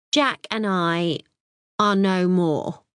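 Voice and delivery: slow, weighty delivery with a pause before the news; hammed-up drawn-out announcement; dramatic pause. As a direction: play the trump card, slowly. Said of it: "she pauses dramatically".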